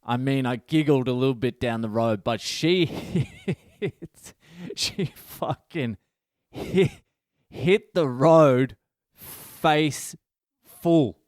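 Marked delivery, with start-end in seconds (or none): 2.4-11.2 breaking up mid-sentence, words squeezed out; laughing. Real, not acted. Comic retelling a cyclist's face plant, laughing. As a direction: fight through laughter, halting and slow.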